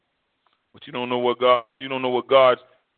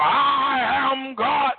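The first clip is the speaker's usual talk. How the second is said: God voice; 8 semitones higher, much slower than usual. Acted. Preacher voicing God slowly.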